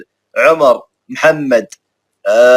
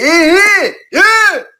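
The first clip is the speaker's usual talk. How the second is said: excited squeal. Acted. Squealing greeting to a viewer.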